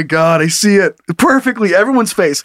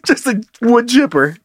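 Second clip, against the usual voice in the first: usual speaking voice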